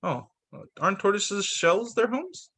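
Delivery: usual speaking voice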